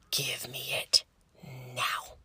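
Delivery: hushed, low ultimatum; quietly menacing nurse; stern low demand. Retold or imitated. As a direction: order it under your breath, pausing before NOW. Nurse repeats order, retold.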